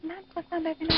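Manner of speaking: soft, barely audible voice; very quiet. Real, not acted. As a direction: speak too softly to be heard well. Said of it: "صداتون خیلی، خیلی آهسته است".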